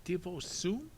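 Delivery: put-on a small child asking a simple question; baby voice. Sunny the baby asking her siblings, in a light toddler voice. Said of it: "Sonny asked".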